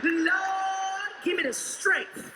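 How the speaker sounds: high, wailing plea to the Lord; exasperated cry for heavenly patience; indignant prayer. Acted. Woman's indignant prayer in story.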